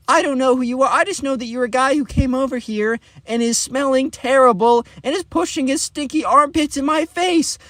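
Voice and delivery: high, shrieky mock indignation; outraged rejection. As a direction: tell off a creep in hammed-up outrage. Ava angrily rejects Ben publicly.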